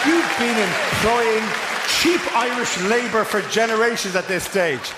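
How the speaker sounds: high, slow, mock-dramatic shout; mock accusation. Accusing the builder before the crowd, hammed-up. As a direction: unmask the villain with hammed-up outrage.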